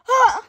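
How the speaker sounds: very high, repeated mock yelps of a boy being beaten; imitated cries of pain. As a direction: yelp each "ah" like the boy getting whooped. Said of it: "All I heard was".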